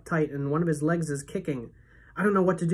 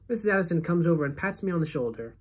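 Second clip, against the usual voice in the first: ordinary delivery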